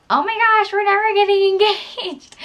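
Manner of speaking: high, mock-whiny complaint breaking into a laugh. Girlfriend complaining to boyfriend about no proposal, high mock whine. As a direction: play up the pouting, laughing through it. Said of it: "complain to him".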